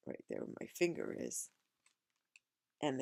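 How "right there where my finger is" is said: ordinary delivery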